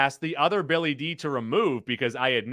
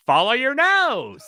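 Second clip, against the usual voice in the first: lightly voiced cartoon toucan, higher and louder; a hint of the cereal-box toucan; Toucan Sam impression; 8 semitones higher, 5 dB louder than usual. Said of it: "That was an incredible Toucan Sam impression".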